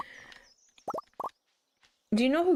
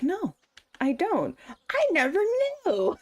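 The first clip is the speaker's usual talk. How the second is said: high, hammed-up denial; mock astonishment; 10 semitones higher than usual. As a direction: protest it high, stretching the last word. Acts out own reaction to special.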